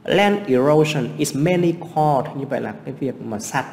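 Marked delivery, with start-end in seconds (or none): 0.0-2.4 slow, careful reading of an English sentence; teacher reading an exam sentence very slowly; slow reading aloud. Shown as a demonstration. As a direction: read the English line out word by word for the class. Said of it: "tìm cái từ gần nghĩa".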